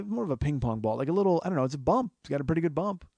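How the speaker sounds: usual speaking voice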